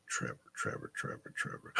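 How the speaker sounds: soft whisper, searching memory; whispering a name. Real, not acted. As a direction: breathe the name over and over, trying to recall. Muttering a half-remembered name.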